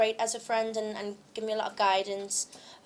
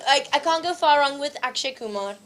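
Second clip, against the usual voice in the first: normal talking voice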